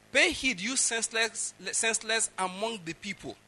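scripture rebuke. Acted. Preacher reading the next line to the people, proclaimed.

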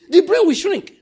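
high, loud pronouncement; thundering proclamation. Real, not acted. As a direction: declare it like a curse. Proclaiming curse on juju men.

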